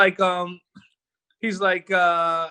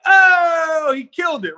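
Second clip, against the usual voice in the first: loud, high burst of hype on the oh; excited shout; 9 semitones higher, 6 dB louder, slower than usual. Retold or imitated. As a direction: cheer it like the take was perfect. We're like.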